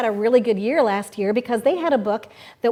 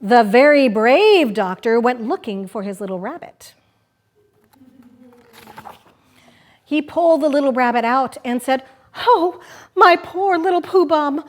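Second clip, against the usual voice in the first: lively picture-book reading; reading to children. Acted. Host reading Pooh Bum aloud on the radio, playful storytelling. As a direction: read it like story time for kids.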